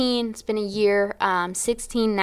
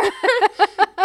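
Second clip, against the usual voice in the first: high laugh; 12 semitones higher, 4 dB louder than usual. Real, not acted. Laughing after the question.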